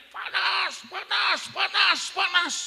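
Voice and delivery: high, loud cry of panas; screaming. Retold or imitated. Man under prayer screams, high and loud. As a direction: yell panas as if burning. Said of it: "Dia teriak".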